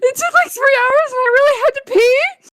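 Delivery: high, tearful imitation of a friend; exaggerated weepy voice; mock crying. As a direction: whimper it out, hammed-up. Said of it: "why are you crying".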